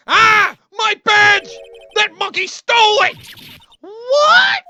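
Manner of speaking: loud high panicked shout. Real, not acted. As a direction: yell it in alarm. Badge stolen, shouting.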